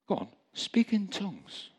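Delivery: high-pitched coaxing nudge; little voice, insisting. Retold or imitated. Little voice urging the sobbing man on, high and insistent. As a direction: urge it again, pitched well up. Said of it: "a little voice came to me and said".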